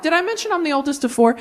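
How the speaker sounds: normal talking voice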